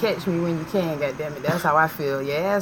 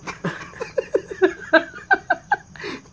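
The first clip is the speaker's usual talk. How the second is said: high laugh; 4 dB louder than usual. Real, not acted. Laughing at own bit.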